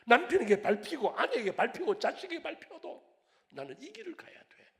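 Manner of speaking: loud, high preaching climax; impassioned preaching. Acted. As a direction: cry out the vow with full force.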